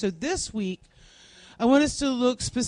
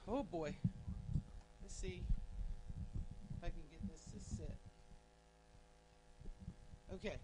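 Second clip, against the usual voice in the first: very soft, low aside; barely audible mumble while fiddling; muttering to oneself; 5 semitones lower, 24 dB quieter, much faster than usual. Real, not acted. Teacher trying to get something to sit without falling, muttering to oneself. Fumbling with something that might fall.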